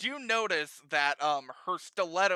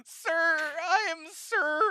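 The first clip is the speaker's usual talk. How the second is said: high-pitched hammed-up blubbering; sobbing Sir Norwood; 12 semitones higher than usual. Wounded captive names himself to his captors, mock sobbing. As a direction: play a captive choking out his name through tears. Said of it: "just crying and sobbing".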